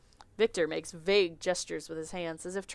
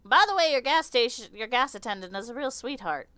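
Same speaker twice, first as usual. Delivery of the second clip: impatient insect lady; 6 semitones higher, 6 dB louder than usual. Acted. Insect customer ordering snacks from the clerk, haughty. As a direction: order like a bored grand dame.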